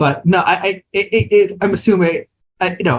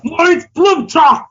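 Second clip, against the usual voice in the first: another hammy mock German accent, higher, slow and shouted; 11 semitones higher, slower than usual. Retrying the German accent his cohost called Russian. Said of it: "you just did the same thing twice".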